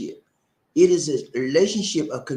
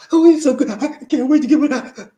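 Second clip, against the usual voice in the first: loud, high swooning mock woman; 11 semitones higher, 10 dB louder than usual. Host mocking a smitten woman, loud and swooning.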